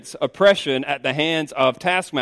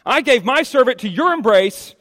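"your" hit hard in a raised voice; stress on YOUR; 8 semitones higher, 5 dB louder than usual. Acted. Wife blaming husband, with "your" punched. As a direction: lean accusingly on "your". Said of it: "notice that, the you".

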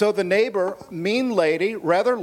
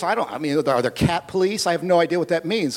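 ordinary talk, nothing marked

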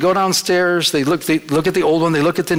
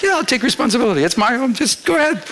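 much higher, quick and carefree; breezy reassurance; 7 semitones higher than usual. Retold or imitated. Telling the installers not to worry, carefree and much higher. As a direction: wave off the worry happily.

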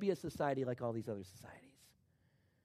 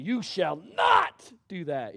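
loud, high and very slow, "not" held long; stern parent shout; 6 semitones higher, 12 dB louder, much slower than usual. Shown as a demonstration. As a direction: bark it at the kids so they know you mean it. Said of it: "When I say that to my kids".

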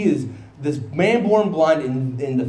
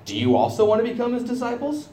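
sarcastic question; 9 semitones higher than usual. Acted. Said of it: "He gets a little bit sarcastic with them".

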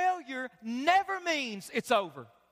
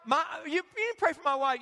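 normal talking voice

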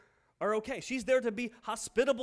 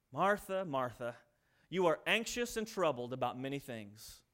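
gentle; 8 semitones lower, 4 dB quieter than usual. Acted. Jesus gently rebukes Martha.

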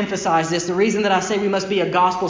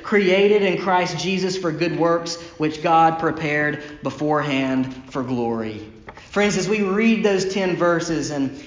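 ordinary talk, nothing marked

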